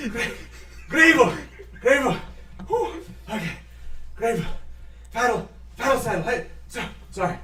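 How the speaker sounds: high, gasping, winded voice; mayor winded from running downhill; out-of-breath mayor. Acted. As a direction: pant between words after running. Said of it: "He's like".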